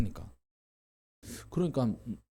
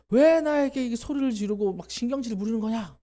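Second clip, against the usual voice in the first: high exasperated outburst; angry retort; 9 semitones higher than usual. Retold or imitated. Jacob scolding his wife, indignant exasperated voice. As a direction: snap back indignantly.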